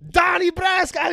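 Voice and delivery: loud, high, drawn-out mock holler; hailing shout. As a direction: bellow the nickname across a room. Imitates sub hailing him at restaurant.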